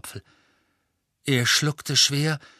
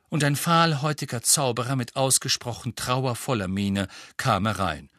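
plain reading voice

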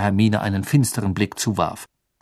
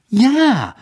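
high, loud, heated yes; worked up; 14 semitones higher, 6 dB louder than usual. A narrator's reading. Hermine gets worked up over Winky's dismissal, indignant. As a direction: burst out indignantly. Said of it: "regte sich Hermine auf".

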